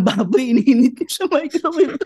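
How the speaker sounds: high, loud, cracking up; amused, incredulous high-pitched telling; laughing storytelling. Real, not acted. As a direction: tell it through rising laughter. Laughing through funny pork-head story.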